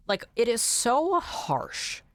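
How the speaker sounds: slow, hard, exaggerated American consonants on "so harsh"; mock harsh American. Shown as a demonstration. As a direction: lean into the harsh American sound. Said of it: "strongest consonants".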